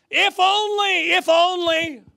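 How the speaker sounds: high, loud, drawn-out wail; regretful cry. Acted. Preacher voices regret, wailing high and loud.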